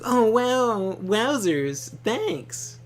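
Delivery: put-on high, loud gee-whiz kid voice; wholesome 1950s kid. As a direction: squeal it like a delighted little kid. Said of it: "he's like".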